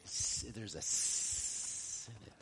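long hissing "S-", held on the sound; low, soft line with a lingering hiss on "S-". Shown as a demonstration. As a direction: draw out "S-" as a soft, unvoiced hiss. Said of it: "Listen, you'll hear it".

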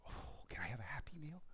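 put-on soft whispered little-kid request; a little boy whispering for a Happy Meal; child's whisper. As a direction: whisper it like a shy small child.

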